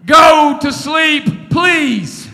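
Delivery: slow, loud, drawn-out plea; tired father's loud, slow plea to sleep; pleading shout. Retold or imitated. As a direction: drag out the order, begging a bit. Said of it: "I said".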